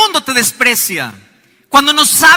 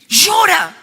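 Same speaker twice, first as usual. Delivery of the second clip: urging shout; 6 semitones higher than usual. Retold or imitated. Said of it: "le digo".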